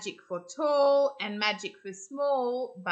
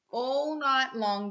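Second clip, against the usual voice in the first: ordinary delivery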